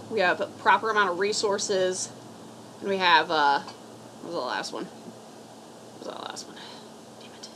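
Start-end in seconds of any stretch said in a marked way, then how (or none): 4.9-7.5 trying to recall under your breath; a hushed slow aside; whispered to self. Real, not acted. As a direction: whisper it to yourself, slowly. Forgot the list item, muttering.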